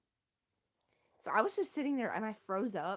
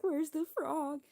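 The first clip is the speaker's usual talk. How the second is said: high-pitched retold wail; mock sobbing; 8 semitones higher than usual. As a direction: act out a milder desperate wail. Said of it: "I'm, like, sobbing in the rain".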